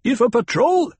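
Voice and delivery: high, loud, cut-off warning; urgent warning call. A narrator's reading. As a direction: call out the danger from above. Interrupted warning from rocks.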